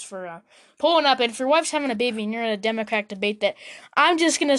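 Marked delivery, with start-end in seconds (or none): none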